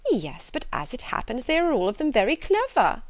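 high, emphatic character voice; fussy mother contradicting; proud, protesting mother. A narrator's reading. Mother proudly contradicts her husband.

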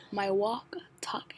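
ordinary delivery, nothing marked

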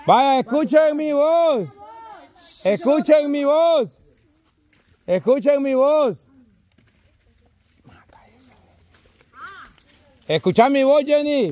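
high, slow guiding call. Real, not acted. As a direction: stretch each phrase so they can follow it. Guiding blindfolded players by voice. (llamando despacio, con voz aguda)

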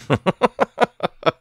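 high, bouncing run of chuckles. Real, not acted. Player amused by calling oil Texas tea, chuckling. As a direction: laugh at your own little joke.

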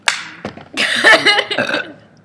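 bursting into loud high laughter. Real, not acted. Laughing at poop remark.

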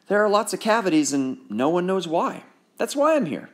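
a hint of worry in the dentist; lightly voiced sad dentist. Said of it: "his happy face now sad".

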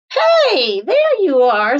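high loud call; cheery hello. Acted. Cow calls out to Duck at the pond, loud and high. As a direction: Cow hails Duck brightly.

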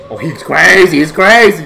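loud, high hype shout; excited shout. Real, not acted. Filming a friend's wild play, loud excited shout. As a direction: yell it out, amazed by the move.

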